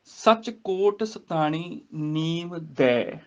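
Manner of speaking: slow recitation with long nasal ਨੀਮ, open ਦੈ; nasalised ਨੀਮ. Shown as a demonstration. Teacher modelling the verse, slow careful ਨੀਮ ਦੈ. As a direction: stretch ਨੀਮ and ਦੈ apart. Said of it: "ਨੀਮ ਬਿੰਦੀ ਨਾਲ ਉਚਾਰਨ".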